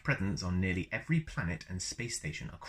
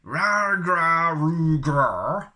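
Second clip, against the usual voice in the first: loud, high mock Wookiee roar; 7 semitones higher, 10 dB louder, much slower than usual. Shown as a demonstration. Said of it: "Also helpful".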